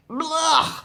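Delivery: high, loud yuck of disgust; loud disgusted blah; disgusted spit. Acted. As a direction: spit out a mouthful of mud. Tiger spitting out mud.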